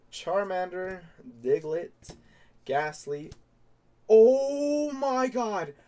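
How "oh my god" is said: high, slow shout of disbelief; ecstatic scream. Real, not acted. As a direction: shriek with sheer joy. Pulls Charizard, huge reaction.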